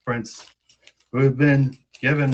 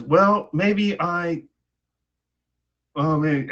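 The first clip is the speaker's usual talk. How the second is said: hesitant; 6 semitones higher, 4 dB louder, much slower than usual. Acted. Princess hesitates to speak.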